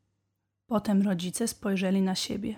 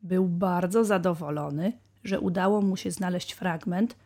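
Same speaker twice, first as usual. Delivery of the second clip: usual speaking voice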